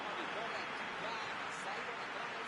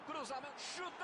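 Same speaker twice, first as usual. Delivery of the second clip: rising shout; 5 semitones higher than usual. Real, not acted. Goal scored, commentator erupts.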